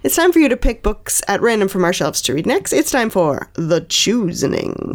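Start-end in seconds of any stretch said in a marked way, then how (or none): 2.7-4.9 slow, deep announcer voice; dramatic announcer. Pretend. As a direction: build it up like a trailer.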